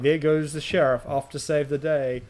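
loud retold taunt; mocking jeer. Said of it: "start making fun of him".

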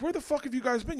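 high-pitched exasperation; retold indignant scolding. Parent scolding the aides after they agree, indignant. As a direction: demand to know, baffled.

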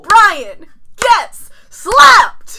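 loud, drawn-out shout; excited shout. Real, not acted. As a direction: announce it with a big yell. Dramatic reveal of the slap.

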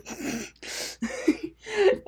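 bright high laugh bursting out. Real, not acted. Podcaster laughing at her own joke, high burst.

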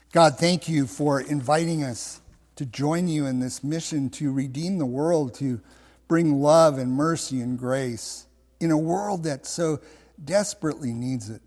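measured, restrained prayer voice; closing prayer. Real, not acted. Pastor thanks God for the church, in prayer. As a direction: pray sincerely, pausing between phrases.

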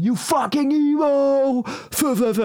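high, slow jeering yell out of a car window; mocking shout. Teen in a passing car jeering at the new kid, high mocking yell. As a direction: sneer it like a teen bully hollering from a car. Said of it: "råber af mig".

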